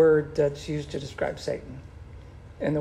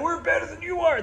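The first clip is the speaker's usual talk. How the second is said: much higher, mock-taunting stadium fan; fan trash talk; 12 semitones higher than usual. Preacher imitates fans trash-talking the Cowboys, high mock taunt. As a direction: jeer it like a bragging fan in the stands. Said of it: "they're yelling and they're bragging".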